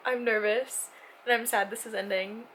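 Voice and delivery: ordinary talk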